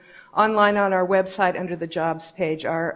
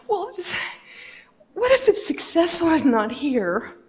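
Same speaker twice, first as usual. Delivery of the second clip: higher, teary quote with a sob; retold crying; 9 semitones higher than usual. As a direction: let the voice crack on the plea. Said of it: "I start crying".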